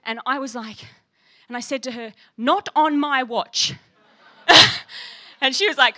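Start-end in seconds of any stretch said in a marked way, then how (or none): 2.4-3.7 slow, loud, every word weighed out; passionate declaration. Retold or imitated. To the Bible Society woman, a slow passionate vow. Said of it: "Whoa, you're so passionate". 4.5-5.4 loud laugh. Real, not acted. Laughing in front of the Bible Society woman. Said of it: "'cause I was laughing".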